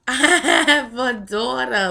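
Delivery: choked-up laugh on joyful disbelief; close to tears. Retold or imitated. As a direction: laugh it out, nearly crying. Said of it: "I'm about to cry right now".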